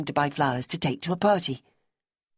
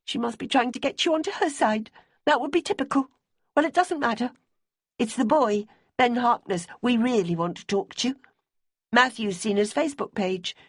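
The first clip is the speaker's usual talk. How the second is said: higher, pleading woman's voice; Debbie's voice; 8 semitones higher than usual. A narrator's reading. Debbie asking Simmy about Bonnie's boyfriend, high and earnest. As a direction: voice a worried, earnest widow.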